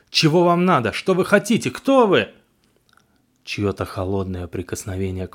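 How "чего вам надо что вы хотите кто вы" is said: full-voiced terrified yell, high and loud; shouting. A narrator's reading. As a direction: scream it at the top of your lungs. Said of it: "во весь голос крикнул".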